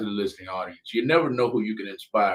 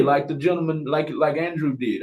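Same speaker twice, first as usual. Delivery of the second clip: ordinary delivery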